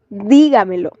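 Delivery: "dígamelo" as a demo, strong first syllable; stress fourth from end. Shown as a demonstration. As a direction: hit DÍ in "dígamelo", then let the rest fall. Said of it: "el acento está en la cuarta sílaba desde el final".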